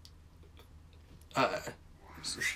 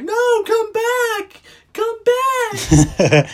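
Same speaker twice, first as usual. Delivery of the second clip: very high, slow, loud mock wail; hammed-up wail; 8 dB louder, slower than usual. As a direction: wail after the kids, hamming it up. Girl begs fleeing kids.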